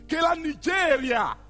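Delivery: high, loud proclamation; emphatic declamation. Acted. Narrator revealing who sent the waste ship back, emphatic declamation.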